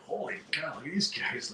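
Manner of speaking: whisper-quiet, impressed by the band; awed hush. Retold or imitated. Opening band awed by them.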